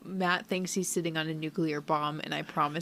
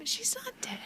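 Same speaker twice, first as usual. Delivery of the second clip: whisper; 5 dB quieter than usual. Real, not acted. Insisting she's not dead.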